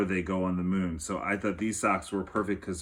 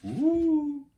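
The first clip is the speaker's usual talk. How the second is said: very high, long ooh; excited ooh; 6 dB louder than usual. Real, not acted. Storyteller coos in delight at the next chapter. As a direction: coo with delight.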